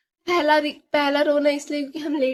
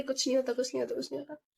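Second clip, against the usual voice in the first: soft, fading repeated reassurance; soothing hush; 9 dB quieter, much faster than usual. Retold or imitated. Brother calming his crying sister, soft soothing murmur. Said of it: "वो मेरे को शांत करवा रहा है".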